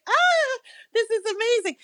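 very high, gasping squeal of amazement; amazed squeal. Retold or imitated. Telling her friend about her first soundcheck, a high amazed squeal. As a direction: gush like a starstruck kid. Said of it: "And I was like".

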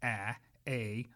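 "ah" then "a" sounded out in turn; English vowel demo. Shown as a demonstration. Professor contrasting English vowels with Spanish, sounding them out. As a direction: demonstrate the English vowels slowly. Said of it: "But we have ah".

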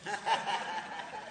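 high soft giggle; a quick high chuckle; laugh. Real, not acted. Laughs at ugly babies.

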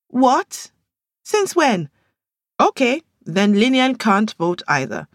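high, startled protest; quick indignant retort. Acted. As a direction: fire back fast. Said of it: "Amaka said quickly".

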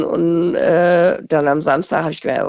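usual speaking voice

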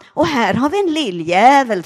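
loud deranged shout; stepfather's shout. Retold or imitated. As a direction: bellow it like a drunk raving man. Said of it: "och så sa han".

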